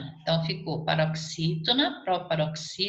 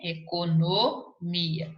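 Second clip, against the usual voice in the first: "Economia" slowly split, lingering on NO and MI; teacher sounding out "Economia" in slow syllables; much slower than usual. Shown as a demonstration. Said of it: "Não tem um acento circunflexo aqui".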